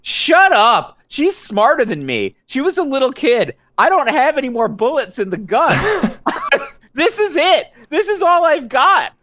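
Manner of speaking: very high, hammed-up desperate protest; mock exasperated outburst. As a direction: protest in comic despair, voice way up. Mock outburst at sister's scolding.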